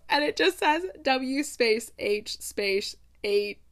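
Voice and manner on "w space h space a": deliberate, spaced-out letters; each letter and space drawn out; slow spelling out. Shown as a demonstration. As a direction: spell it out slowly for effect. Spells out the character's stunned thought.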